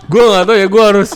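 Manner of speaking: talking through laughter; amused, laughing while talking. Real, not acted. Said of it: "jokes ini".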